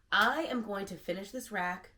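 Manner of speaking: normal talking voice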